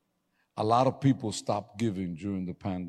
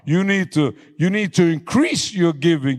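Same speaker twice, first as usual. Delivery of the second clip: high, loud, driving sermon climax; full-force preaching; 5 semitones higher, 10 dB louder than usual. Acted. Pastor shouting his vow to God to the church, high and loud.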